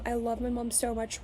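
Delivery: ordinary talk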